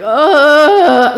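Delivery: high wailing groan; imitated crying. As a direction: wail as if crying in pain.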